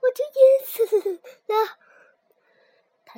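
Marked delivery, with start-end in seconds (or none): 0.0-1.8 shaking, stammering after near drowning; shaky voice. Acted. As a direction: voice trembling with fright. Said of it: "声音还在发抖".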